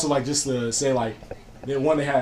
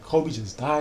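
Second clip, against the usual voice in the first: ordinary delivery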